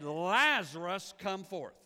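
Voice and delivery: long, drawn-out loud call of the name; commanding call. Retold or imitated. Jesus calling Lazarus out of the tomb, loud and drawn out. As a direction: call him out of the grave, booming.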